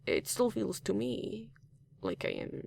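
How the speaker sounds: ordinary talk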